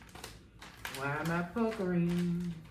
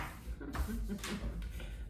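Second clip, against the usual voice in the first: soft laugh; 3 semitones higher, 8 dB quieter than usual. Real, not acted. Laughing at sticky paper.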